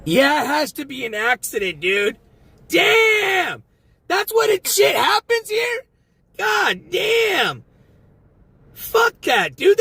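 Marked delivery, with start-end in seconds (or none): 2.7-9.8 high, loud shouts of disbelief; bellowing at the navigation app in shock; real yelling. Real, not acted. Driver yelling at his phone's navigation in disbelief. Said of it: "Sorry I'm yelling".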